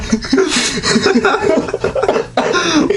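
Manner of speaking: high helpless laughter; burst of laughter. Real, not acted. Cracking up at the text.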